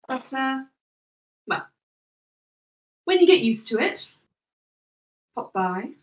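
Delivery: soft, measured, unhurried farewell; lightly voiced smiling Sorella. Sorella bids Cass farewell, softly composed. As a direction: take your leave softly, with pauses.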